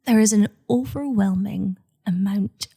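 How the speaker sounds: hushed unvoiced whisper. Real, not acted. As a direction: whisper it like a secret. Mock horror over lime green.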